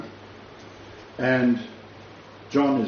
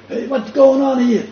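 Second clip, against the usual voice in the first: put-on high, loud Italian-accented guard calling out; a jumpy Roman soldier in a thick Italian accent; hammed-up Italian-accented alarm; 13 semitones higher, 6 dB louder than usual. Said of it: "in their thick Italian accents".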